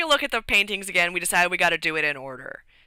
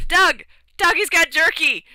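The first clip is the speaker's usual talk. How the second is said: high, loud excited call to a friend; rat calling out; 10 semitones higher, 7 dB louder than usual. Retold or imitated. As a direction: yell across to Doug, thrilled.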